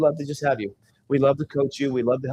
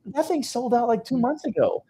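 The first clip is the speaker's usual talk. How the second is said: high disbelieving sold out; mock incredulity at the student; incredulous; 10 semitones higher than usual. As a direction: pitch it up in disbelief. Incredulous reply.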